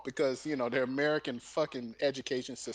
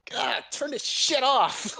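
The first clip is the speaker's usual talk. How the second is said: slow, high-pitched fed-up plea; mock exasperation; 7 semitones higher, 3 dB louder, slower than usual. Acting a viewer sick of constant noise, exasperated. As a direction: groan it out like an overwhelmed viewer.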